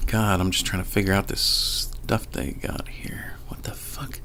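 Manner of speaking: whispered aside under the breath; barely voiced, puzzled grumble to oneself; muttering to self. Real, not acted. Speaker puzzling over the stuff they got here, muttering to self.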